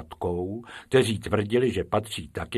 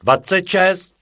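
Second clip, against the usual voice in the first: higher, louder cry of the number; calling out; 9 semitones higher, 9 dB louder than usual. A narrator's reading. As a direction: pipe up from the back. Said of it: "vykřikl druhý".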